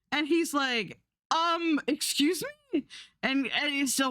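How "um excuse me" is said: high, indignant "excuse me"; rising outrage as the truck leaves; baffled. Retold or imitated. Driver watches the truck leave the coffin, high baffled protest.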